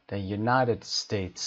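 the United States very slowly, NI of United stressed; slow stressed model. Shown as a demonstration. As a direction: drawl it with weight on NI. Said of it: "کلمهٔ United قسمت نه". (تأکید روی نای در United)

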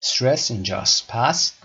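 ordinary talk

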